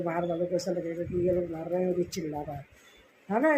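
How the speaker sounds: normal talking voice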